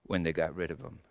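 put-on low, soft, heavy-hearted confession as the old persecutor; a remorseful Saul remembering the stonings; Paul confessing, hushed. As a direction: murmur it like a man ashamed of his past. Paul confessing his past, in character.

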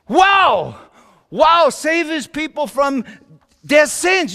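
loud, high-pitched wonder; amazed exclamation. Acted. Amazed reaction to angel's words.

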